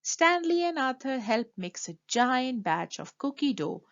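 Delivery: plain reading voice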